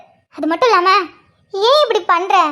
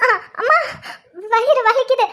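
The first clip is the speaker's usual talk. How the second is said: crying out, higher-pitched with pain; 4 semitones higher than usual. Acted. Pregnant wife calls for mother as cramps hit, in pain. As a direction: whimper through stomach cramps. Acted sudden labour pains, alone.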